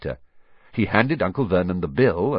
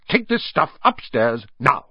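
higher, forceful order; snapping; 5 semitones higher, 4 dB louder than usual. A narrator's reading. Uncle sends boy upstairs, snapping. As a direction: order it done at once. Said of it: "snapped his uncle".

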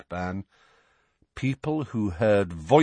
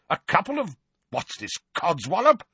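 scornful; 10 semitones higher than usual. A narrator's reading. Vernon scoffing angrily.

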